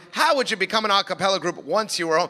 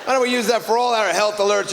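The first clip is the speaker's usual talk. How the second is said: usual speaking voice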